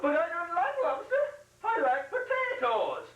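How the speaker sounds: high, whining Irish lilt near tears; mock-tearful Irish whine; whiny Irish brogue. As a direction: whine it like a put-upon Irishman. Irish character whines about potatoes.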